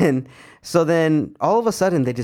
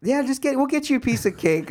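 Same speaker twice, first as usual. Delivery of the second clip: much higher, bubbly mock staff voice; imitating cheery staff; 11 semitones higher than usual. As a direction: chirp it like an oblivious receptionist.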